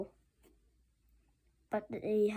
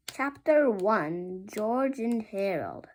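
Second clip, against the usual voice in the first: chapter announcement; 4 dB louder, slower than usual. A narrator's reading.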